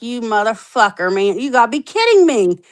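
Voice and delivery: high, outraged disbelief, words tumbling fast; indignant protest climbing in pitch; venting at the parole officer in high outrage; retold exasperated rant.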